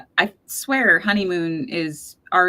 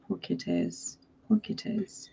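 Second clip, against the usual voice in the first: hushed, pitying murmur; soft sympathy; 10 dB quieter than usual. Real, not acted. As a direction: say it very quietly, trailing into a whisper. Sympathy for lost kittens.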